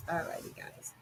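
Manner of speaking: breathy whispered aside. Real, not acted. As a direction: whisper it back to the mic. Back to viewers, near whisper.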